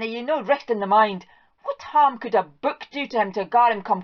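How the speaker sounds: wife nagging the husband about his sense; incredulous scolding wife. Acted. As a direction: shriek the word book, then berate him.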